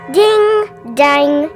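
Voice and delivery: plain reading voice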